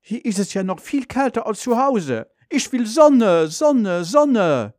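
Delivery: grumble, then wail for what you want; ranting. A narrator's reading. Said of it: "Vater wettert". (wetternd, immer höher und lauter)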